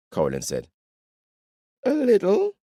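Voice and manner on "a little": ordinary delivery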